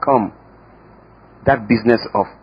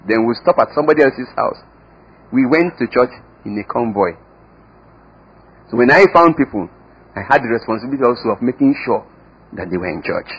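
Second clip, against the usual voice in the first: normal talking voice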